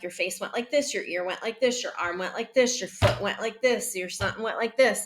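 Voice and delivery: quick, lilting run of observations; rapid-fire listing. Shown as a demonstration. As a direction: rattle them off without pausing. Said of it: "this isn't like".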